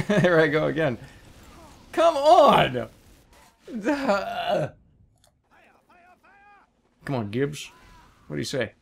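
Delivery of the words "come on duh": high, loud exasperated yell; frustrated shout. Real, not acted. Frustrated outburst.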